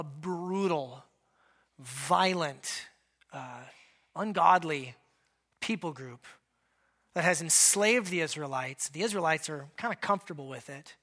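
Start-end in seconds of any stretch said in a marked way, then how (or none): none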